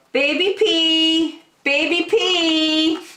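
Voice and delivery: very high, slow, drawn-out call; slow high mock alarm chant; yelled taunt. A narrator's reading. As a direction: sing it out to make everyone panic. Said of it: "Eric yelled".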